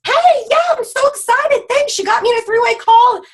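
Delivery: squealy mock excitement from a new team member; excited new recruit. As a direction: play the thrilled newbie, bubbling over. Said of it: "Or she might say".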